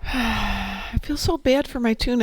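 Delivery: ordinary talk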